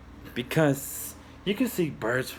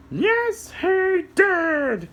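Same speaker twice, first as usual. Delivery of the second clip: high squeaky puppet, slow; a little puppet explaining to kids; high cartoon helper voice; much slower than usual. Puppet answers.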